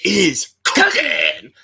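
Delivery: high, drawn-out wrestling catchphrase bellow; The Rock. Acted. Fan introduces his top four pick, hammed-up catchphrase impression. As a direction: stretch the words out like a ring entrance boast.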